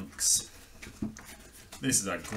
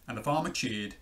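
usual speaking voice

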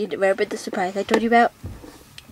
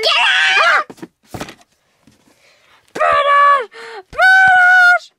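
much higher, louder screams; panicked scream; 5 dB louder, slower than usual. Pretend. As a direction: shriek for help in a panic. Doll panics, screams for Brutus.

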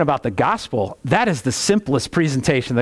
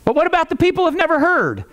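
very high, quick mock protest; imitated objector; 13 semitones higher, faster than usual. Preacher mimicking people who make that argument, high-pitched protest. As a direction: throw out the objection high and fast. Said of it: "only people I ever hear make that argument".

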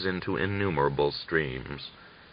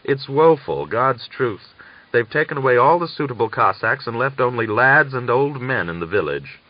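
higher, louder mock lament; complaining; 5 semitones higher, 4 dB louder than usual. A narrator's reading. Daria complains to Natalia about the missing Cossacks. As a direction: moan about it for show. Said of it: "to complain to Natalia".

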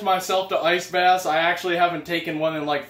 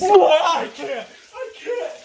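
gasping from cold; 10 semitones higher, slower than usual. Real, not acted. Face under ice water.

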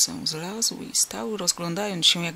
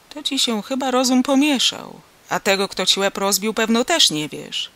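loud, pitched-up mocking reproach; irritated farmer, voice raised; exasperated scolding; 4 semitones higher, 6 dB louder than usual. A narrator's reading. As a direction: scold someone you think is feigning. Farmer scolds the confused stranger.

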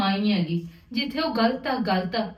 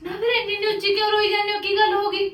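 high-pitched, anxious concern; worried questioning; 7 semitones higher than usual. Acted. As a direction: press her for what happened, pitch raised. Niece asks why aunt cries.

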